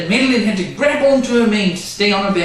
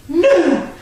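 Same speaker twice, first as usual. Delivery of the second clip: cried; 11 semitones higher, 4 dB louder than usual. Acted. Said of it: "cried Madeline".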